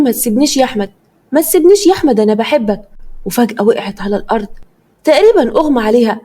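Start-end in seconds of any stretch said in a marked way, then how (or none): none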